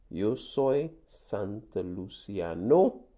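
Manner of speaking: Yo soy Santa Luciano said very slowly; very slow model. Shown as a demonstration. Teacher modelling the nationality answer, very slow. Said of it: "¿Cuál es tu nacionalidad?".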